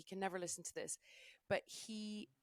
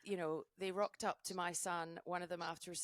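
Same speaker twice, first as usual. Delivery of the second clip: ordinary talk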